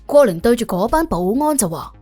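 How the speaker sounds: usual speaking voice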